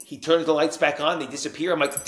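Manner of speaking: ordinary talk, nothing marked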